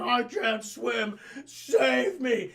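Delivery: slow, hammed-up cry for rescue; mock drowning plea. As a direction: wail for help, half laughing. Acted drowning man calling for help.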